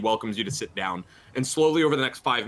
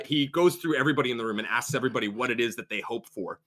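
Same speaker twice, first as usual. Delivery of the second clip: ordinary talk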